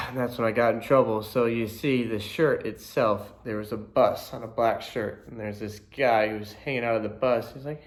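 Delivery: ordinary talk, nothing marked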